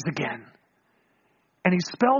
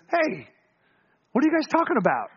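casual higher-pitched mock-innocent question; playful; 5 semitones higher than usual. Jesus drops in on the travelers' talk, playfully innocent. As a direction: ask it lightly, a little higher. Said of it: "Jesus is hilarious".

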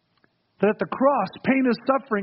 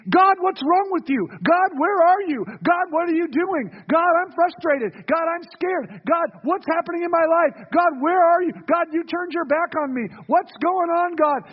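anguished plea; 8 semitones higher than usual. Retold or imitated. Preacher acting a sufferer crying out to God, high anguished pleading. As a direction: throw each question up to heaven in anguish.

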